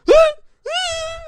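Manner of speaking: high pained yelp, replayed for laughs; pained cry. Recalling the catheter pulled out, a high pained cry. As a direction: squeal like it really hurts, half mocking. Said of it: "I fucking made a noise like no other".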